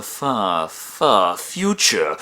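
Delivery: drawn-out words. Acted. Dramatic stretch on a distant future.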